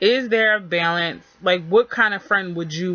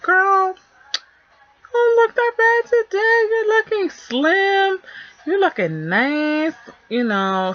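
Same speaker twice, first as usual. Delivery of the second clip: high, sweet, fake-nice lie; sugarcoating friend voice; 4 semitones higher, slower than usual. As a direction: gush it high and syrupy. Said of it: "do you want the friend to be like".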